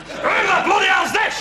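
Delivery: high rough shout of a burly flatmate. Retold or imitated. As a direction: bellow it as the stocky flatmate. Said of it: "she shouts".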